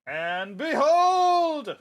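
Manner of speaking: loud, high, very drawn-out proclamation; slow, swelling theatrical shout; grand villainous reveal. Acted. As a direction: unveil it with huge showmanship. Villain unveils portal with flourish.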